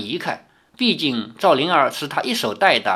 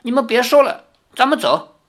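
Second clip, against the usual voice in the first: put-on much higher, louder, embarrassed girl stamping her foot; flustered protest; 9 semitones higher, 3 dB louder than usual. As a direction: cry out in shy embarrassment to hush the maids. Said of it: "跺着脚说". (又羞又急、提高音调跺脚说)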